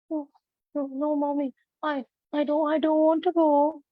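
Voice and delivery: put-on high, trembling scared child pleading; scared quivering voice. Shown as a demonstration. Child begging mommy not to go: high quivering fear. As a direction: plead like a frightened child about to cry. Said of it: "Then the voice might be like".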